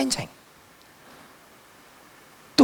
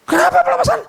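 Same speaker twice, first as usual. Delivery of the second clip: high, loud, startled teacher; alarmed teacher; 6 dB louder, faster than usual. Retold or imitated. Teacher loudly asking why it is deliverance, high and alarmed. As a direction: demand it sharply, pitched way up.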